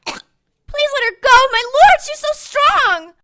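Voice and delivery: high, loud desperate pleas; desperate pleading. Acted. Nun begging the patient to release her sister. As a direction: beg in terror.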